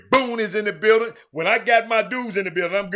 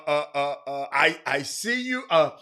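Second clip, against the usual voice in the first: ordinary talk, nothing marked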